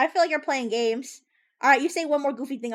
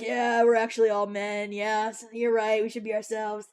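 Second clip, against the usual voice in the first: low, dopey mock-male voice; dropped stupid voice; 6 semitones lower than usual. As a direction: drop your voice down and sound really stupid. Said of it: "drop our voices down to, like, stupid".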